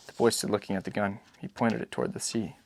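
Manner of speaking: plain reading voice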